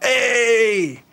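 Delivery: high, bright greeting call; excited hello to a fan; mock cheerful hey. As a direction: greet the fan with big delight. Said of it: "But I was like".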